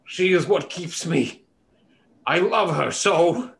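slow, pleading monster voice; pleading beast. Acted. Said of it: "the beast ... continues to say".

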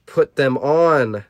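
ordinary delivery

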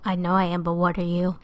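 loud teasing comeback; loud playful retort. Real, not acted. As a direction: fire back loudly.

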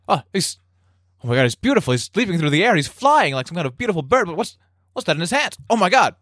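high-pitched amazed wonder; awestruck soldier. Acted. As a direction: gape at an astonishing sight, voice up high. Soldier watches attacker leap, awestruck.

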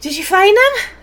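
high, loud, breathless rush; winded Radcliffe. Acted. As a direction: blurt it out, out of breath and excited. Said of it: "very windedly".

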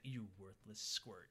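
slow, low, quiet mock taunt. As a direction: drag out the insult softly, imitating a taunter. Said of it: "Fake Carmen's taunting Junie".

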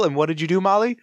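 ordinary delivery

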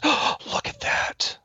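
gasp, then slow whispered horror; imitated shocked whisper. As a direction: act out a mother's hushed shock, drawn out. Mother's shocked reaction acted out.

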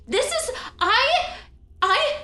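a shrill betrayed would-be queen, voice cracking with outrage; distraught high-pitched Trinity. Acted. Trinity accuses the twin, high and wailing.

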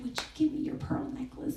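breathy whispered bedtime question; dad's whisper. Retold or imitated. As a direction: whisper it softly to the child. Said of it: "and he said".

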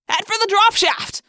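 high shouted direction; urgent cry. A narrator's reading. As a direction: call out the escape route. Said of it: "Marco cried".

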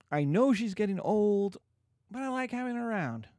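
doting father musing aloud to Paris. Retold or imitated. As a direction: play the fond father reluctant to let go. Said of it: "And Capulet's like".

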